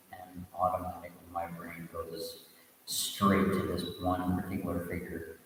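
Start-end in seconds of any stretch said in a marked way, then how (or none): none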